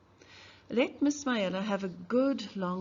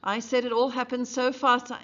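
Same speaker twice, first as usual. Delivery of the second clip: loud, higher furious outburst; raging; 5 semitones higher, 7 dB louder, faster than usual. Acted. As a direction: hurl it at the courtroom. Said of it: "Mayella was raging".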